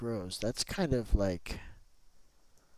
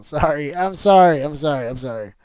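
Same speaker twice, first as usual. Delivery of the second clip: loud apology; 5 semitones higher, 17 dB louder than usual. Real, not acted. Apologizing after she fell.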